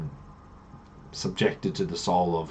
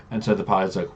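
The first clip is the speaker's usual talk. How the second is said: usual speaking voice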